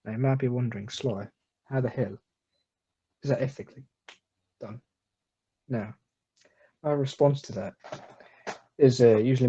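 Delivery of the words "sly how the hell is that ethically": normal talking voice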